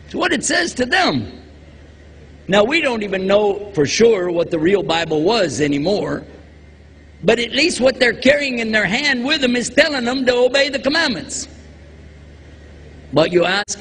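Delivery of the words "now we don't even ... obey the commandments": normal talking voice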